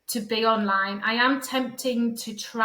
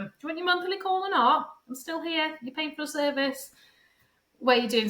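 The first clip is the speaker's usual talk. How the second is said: higher, mock-exasperated chasing; exasperated nagging; 6 semitones higher than usual. Coach chasing a client who skips monthly calls, mock exasperation. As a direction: play a fed-up coach hounding a lazy client.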